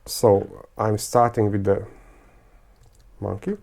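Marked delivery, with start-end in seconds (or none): none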